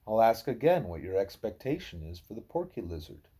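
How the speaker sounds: plain reading voice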